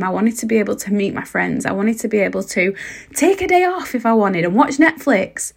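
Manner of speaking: normal talking voice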